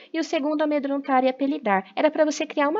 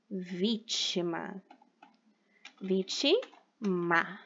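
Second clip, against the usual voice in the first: "vítima" spoken slowly and softly; slow dictation; 5 semitones lower, 7 dB quieter, much slower than usual. Shown as a demonstration. Teacher dictating word to pupils: slow and deliberate. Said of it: "dictating words slowly".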